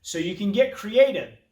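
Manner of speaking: ordinary talk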